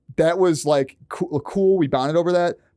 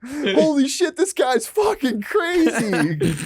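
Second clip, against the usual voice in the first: high, drawn-out panicked wail; mock panic; 12 semitones higher, much slower than usual. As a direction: wail like a terrified carjacker, in jest. Acted reaction of panicked car intruder.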